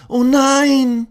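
high, loud little dino gasp; a startled cartoon dinosaur; dino's startled thought. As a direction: dinosaur caught stepping onto the street. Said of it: "denkt Hermann".

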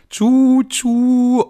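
high, loud choo-choo; train whistle sound. Pretend. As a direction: toot like a toy train.

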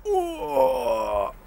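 high squeaky cry of wow; mock amazement. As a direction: overdo the astonishment. Mocking amazement at obvious facts.

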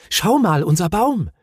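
bright, eager call; child showing off his tree. A narrator's reading. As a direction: call out proudly to the aunt. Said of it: "rief Mumin".

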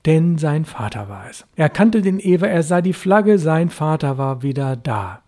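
ordinary delivery, nothing marked

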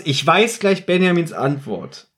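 ordinary delivery

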